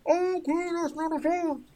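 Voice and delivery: high, muffled voice behind a door. Acted. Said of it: "you just hear a muffled voice on the other side go".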